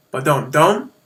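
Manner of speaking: ba-dum-dum, loud first beat then two light; dactyl beat. Shown as a demonstration. Teacher voicing a dactyl, strong-weak-weak beat. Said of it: "a heavy stress followed by two light stresses".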